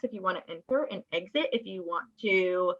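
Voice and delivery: usual speaking voice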